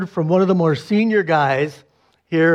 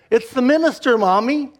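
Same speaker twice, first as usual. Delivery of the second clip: put-on little girl voice; 7 semitones higher than usual. Four-year-old daughter quoted.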